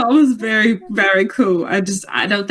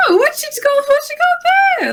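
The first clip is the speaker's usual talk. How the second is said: high, sing-song fawning voice; high-pitched fake interest; faster than usual. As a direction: gush over it in a very high voice. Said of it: "come over and be like".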